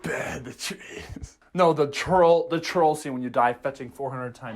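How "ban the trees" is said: slow, drawn-out whisper; whispered game quote. Retold or imitated. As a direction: whisper it, stretching each word.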